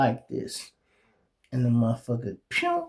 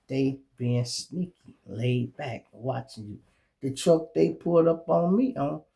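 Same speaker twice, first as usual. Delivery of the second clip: ordinary talk, nothing marked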